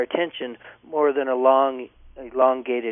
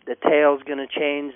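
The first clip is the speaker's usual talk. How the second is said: normal talking voice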